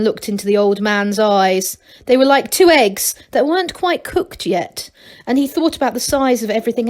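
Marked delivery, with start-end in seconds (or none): none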